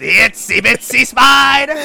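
slow, high, loud cackling villain taunt; sing-song villain tease, slow; campy villain. Retold or imitated. Quoting the Goblin villain.

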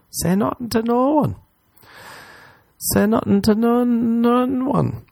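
mock secretive sing-song. Host urging silence about the nun, high and sing-song. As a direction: croon it like a silly secret.

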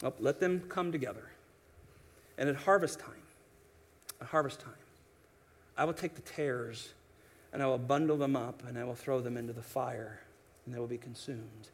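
low, soft, settled master's voice; the landowner tells his servants to wait, low and soft; the master speaking. Retold or imitated. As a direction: hush it down like a calm landowner. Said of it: "the master says".

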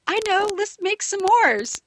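high, slow and eager, voicing Joe's idea; eager suggestion. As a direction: pitch it up and stretch it out like a sudden bright idea. Joe suggests s'mores.

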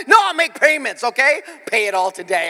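hammed-up indignant correction; mock outrage at paying; loud protest. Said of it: "I'm like".

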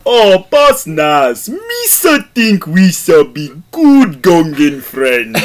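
high, slow, loud Gungan voice; Boss Nass impression. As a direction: draw it out like a goofy Gungan. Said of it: "The first one we have for you is Boss Nass".

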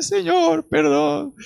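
high-pitched acted tearful plea; high, wailing mock-repentant prayer; imitated crying. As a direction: mimic someone crying out to God for forgiveness. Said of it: "lloraba y lloraba y decía".

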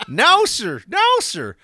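high, loud hammed-up denial; mock protest. Farmer denies torturing the cow, mock protesting.

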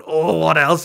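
hammed-up excitement on the phone; mock eager. Flirty excited reply.

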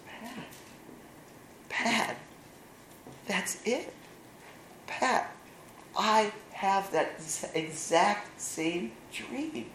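high, slow, breathless wonder; awestruck realization. Retold or imitated. Jeff, stunned, realizing his brother shares his dream. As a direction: let it dawn on you slowly, amazed.

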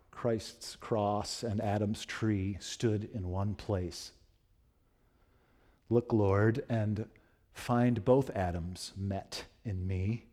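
slow, hushed reading of verse; slow poem reading. Acted. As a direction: let each line of the prayer-poem settle slowly. Reads John Donne poem slowly.

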